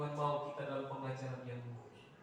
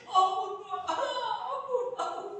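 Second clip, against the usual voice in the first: imitated pleading cry; 9 dB louder than usual. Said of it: "saya kebayang dia putar-putar itu".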